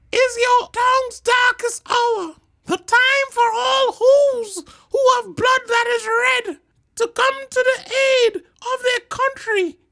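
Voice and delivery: very high loud mayor cry; tiny mayor sounding the alarm. A narrator's reading. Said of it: "cried the mayor".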